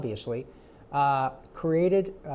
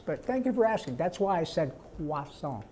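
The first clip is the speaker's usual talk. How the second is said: normal talking voice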